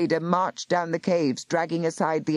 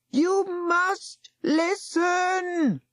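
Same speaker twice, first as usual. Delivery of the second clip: desperate plea; much slower than usual. Acted. Ben calling to hidden doors.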